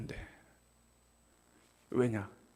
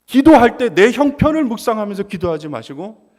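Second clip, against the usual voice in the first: louder, high, pressing sermon exhortation; preaching at full force; 5 semitones higher, 12 dB louder than usual. Acted. Pastor urging the congregation how to pray, fervent loud preaching. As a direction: drive it home to the congregation.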